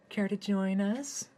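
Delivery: high, slow, seductive invite; sultry orgy woman. Acted. Orgy woman invites Hayden to join, high and slow. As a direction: drawl it out like a temptress. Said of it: "asked one of the women in the orgy".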